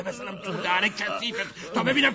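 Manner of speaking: rising into a furious yell; menacing threat. Acted. Gunman threatening his boss, furious yell.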